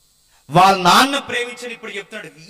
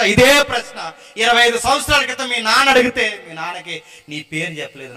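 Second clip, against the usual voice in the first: ordinary delivery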